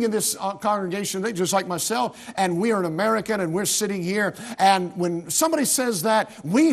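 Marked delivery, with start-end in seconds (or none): none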